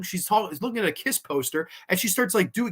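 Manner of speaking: ordinary talk, nothing marked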